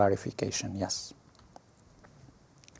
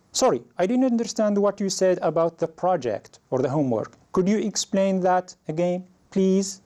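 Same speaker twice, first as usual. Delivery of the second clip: apologetic, higher-pitched student asking the teacher; polite request; 7 semitones higher than usual. Shown as a demonstration. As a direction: politely ask to have it explained, pitched up. Student politely asks teacher for clarification.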